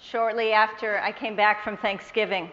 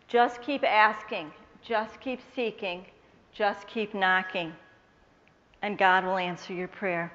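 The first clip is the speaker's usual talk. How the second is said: slow and softer, calm and reassuring; gentle advice; 3 semitones lower, 6 dB quieter than usual. Retold or imitated. Sarah gently advising her to keep praying, slow and soft. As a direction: give the advice softly, like a wise friend.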